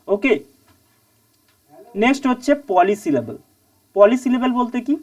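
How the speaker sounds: normal talking voice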